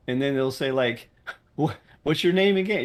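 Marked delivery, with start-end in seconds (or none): 1.6-2.8 higher and louder, dopey question; hammed-up baffled gamer; mock confused player. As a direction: play the clueless player asking back. Mocking a confused player's reply.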